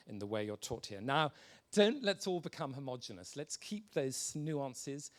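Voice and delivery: ordinary delivery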